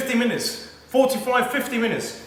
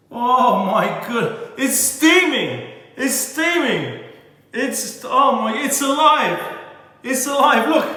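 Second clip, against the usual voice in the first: higher and louder, thrilled; higher, louder, slowed and laughing with excitement; excited delight; 4 semitones higher, 3 dB louder, slower than usual. Real, not acted. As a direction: gasp with glee at the steam. Steam rises from cut cake.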